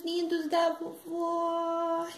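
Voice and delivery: high, drawn-out granny call; doting granny greeting. Acted. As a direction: stretch out a fond greeting to the grandchildren. Granny greets her audience.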